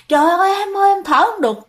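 high-pitched imitation of a panicked plea; retold breathless plea. Choking husband pleads with his wife, imitated panicked cry. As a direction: mimic someone crying out for air, restrained in volume. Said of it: "Thở hổn hển luôn".

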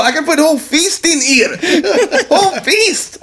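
high-pitched accented clerk impression; imitated store clerk. Clerk amazed by ear holes, imitated high voice. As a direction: mimic the clerk's broken English, pitched high. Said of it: "the clerk, he's all".

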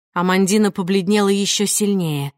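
usual speaking voice

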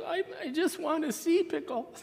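high, soft imitation of a frustrated little girl crying; mock crying. Frustrated daughter pleads with baffled parents, imitated high crying. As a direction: put on a small child's tearful, high-pitched plea. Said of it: "getting really frustrated and started crying".

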